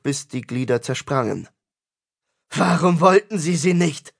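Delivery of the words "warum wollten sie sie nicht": high, insistent question; angry demand. A narrator's reading. Tristan tears the coin from the corpse, angry demand. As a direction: insist on an answer, voice raised.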